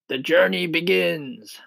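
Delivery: high, slow proclamation; jubilant shout. A narrator's reading. Centipede announcing the journey, high drawn-out shout. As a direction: call it out long and high. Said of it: "shouted the centipede".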